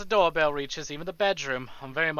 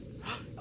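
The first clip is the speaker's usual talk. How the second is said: sharp gasp; 14 dB quieter than usual. Real, not acted. Reacting to chat news.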